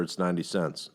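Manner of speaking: usual speaking voice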